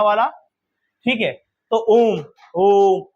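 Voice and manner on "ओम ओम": ओम with the ओ held, slow; drawn-out Om. Shown as a demonstration. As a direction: stretch ओम as a long chanted vowel. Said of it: "थोड़ा सा पुकार के बोलेंगे".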